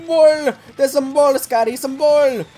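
high, loud yelling at teammates; frantic yelling. Real, not acted. Player yelling at teammate Scotty about the ball, frantic shout. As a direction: yell it up high, outraged and urgent.

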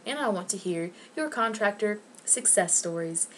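ordinary talk, nothing marked

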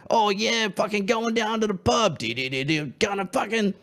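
mocking a punk band putting on a broad Australian accent; fake broad Australian. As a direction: overdo the Aussie bloke inflection. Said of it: "put that inflection on your voice".

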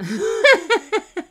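very high, loud laugh. Real, not acted. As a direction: dissolve into high giggles. Laughs at the game.

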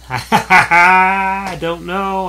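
laughing I don't know, stretched out slowly; giggling, slowly stretched I don't know. Real, not acted. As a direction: laugh through the words and drag them out. Unsure of movie title, laughs.

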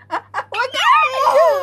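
high, loud mock scolding; playful loud scolding. Parent playfully telling baby off, loud and high. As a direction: shout it high, half laughing.

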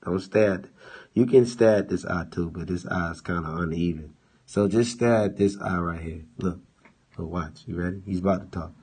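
usual speaking voice

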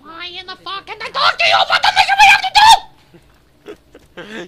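high, frantic squawking; rising shrieky chicken babble. Pretend. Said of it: "You sound like what I would imagine a chicken".

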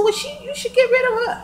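shrieky high, loud protest; retold pissed-off girlfriends. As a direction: imitate an indignant girlfriend, pitch shooting up. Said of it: "they was so fucking pissed off".

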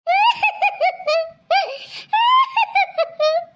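high, theatrical boo-hoo crying; hammed-up wordless wail; fake wailing sobs. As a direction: sob in long high pulses for show. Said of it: "கொஞ்சம் அழுவுற மாதிரி மூஞ்சி வச்சுக்கோ".

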